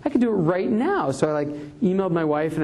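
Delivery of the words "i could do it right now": higher, giddy excited self-quote; light up at the idea; mock-breathless excitement; eager realization. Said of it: "I'm like".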